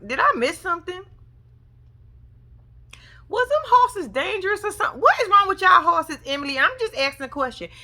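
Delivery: high, incredulous ranting at Emily; exasperated rant. Retold or imitated. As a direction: pile on the disbelief, voice up high. Ranting at couple about horses.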